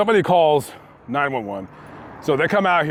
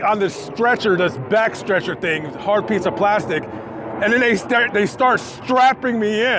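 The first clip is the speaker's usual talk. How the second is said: ordinary delivery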